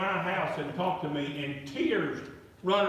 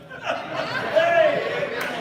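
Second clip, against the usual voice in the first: big laugh; 10 semitones higher, 7 dB louder than usual. Real, not acted. Preacher laughs at his punchline.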